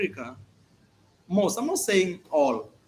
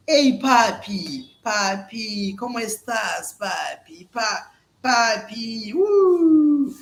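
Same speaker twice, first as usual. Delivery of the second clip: slow, seductive cooing, higher; flirty Colombian woman; 3 semitones higher, 4 dB louder, much slower than usual. Retold or imitated. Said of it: "touching them like here".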